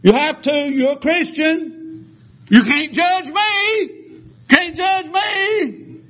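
very high, drawn-out mock protest; mocking whiny demand. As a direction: imitate an indignant complainer way up in pitch. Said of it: "They say".

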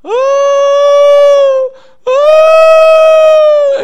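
long high sustained wail, very loud; imitated loud crying. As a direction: mimic a woman wailing at full volume. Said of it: "she got louder and louder".

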